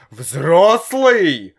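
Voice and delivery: loud hissed echo of the word, seething; father hissing at his son's claim. A narrator's reading. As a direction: spit the word back in fury. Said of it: "Папа так зашипел".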